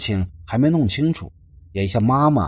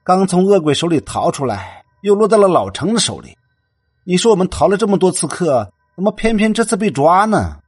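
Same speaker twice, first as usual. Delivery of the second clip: whiny complaint; 6 semitones higher, 5 dB louder than usual. A narrator's reading. Roommate complains after sigh.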